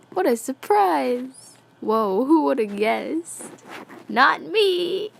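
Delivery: slow, high mock-amazed voice; deadpan wonder laid on thick, drawn out; mock astonishment in a high comic voice; sarcastic. Sarcastic reaction to the twist.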